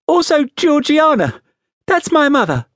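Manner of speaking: put-on high small-boy voice; timid explanation. A narrator's reading. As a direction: explain it nervously like a little child. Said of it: "I timidly explained".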